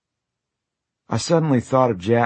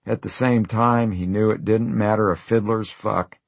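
usual speaking voice

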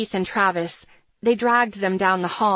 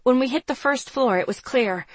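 ordinary delivery

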